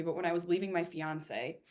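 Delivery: ordinary delivery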